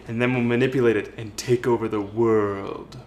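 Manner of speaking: low, quiet scheming villain voice; sneering panto villain. Pretend. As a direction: hatch an evil plan, low and soft. Scheming to take over world.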